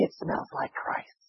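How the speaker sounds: breathy reverent whisper; hushed awe. Real, not acted. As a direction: drop to a whisper for the revelation. Climax of sermon point.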